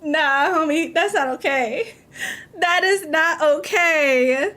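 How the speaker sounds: slow appalled disbelief; incredulous. Real, not acted. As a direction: stretch it out, appalled. Reacting to the story.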